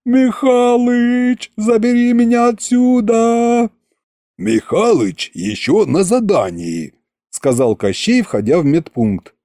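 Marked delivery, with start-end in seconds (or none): 0.1-3.7 high, slow, drawn-out cry for help; hammed-up wail of terror; mock panicked yell. Patient wails for help trying to hide, high and slow. 4.4-6.9 calm king. Acted. Koschei enters calmly.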